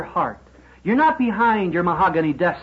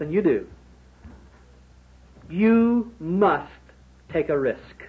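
ordinary delivery

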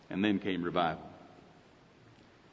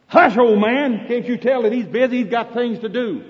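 scolding crowd; 8 semitones higher, 5 dB louder than usual. Acted. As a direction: shush him down impatiently. Crowd scolds the blind man.

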